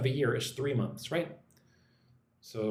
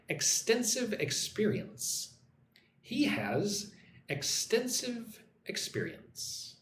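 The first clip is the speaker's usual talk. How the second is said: slow model reading; 7 semitones higher, 3 dB quieter, slower than usual. Shown as a demonstration. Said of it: "model sentence for repeating".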